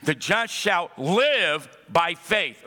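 slow, with stress on "live"; emphatic slow line. Shown as a demonstration. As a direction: lay the words down slowly. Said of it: "guess where the emphasis is?".

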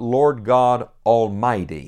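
each word of "Lord God Almighty" weighted and slow; slow emphasis. Acted. Emphasising God's power.

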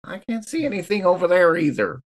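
ordinary talk

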